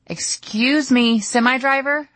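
calling out loud and slow; yelling. Retold or imitated. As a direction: yell to get the driver's attention. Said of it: "I yelled at the man".

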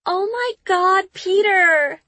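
high, slow gasp of delighted shock; surprised. A narrator's reading. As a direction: cry out his name in happy disbelief. Stunned by surprise party.

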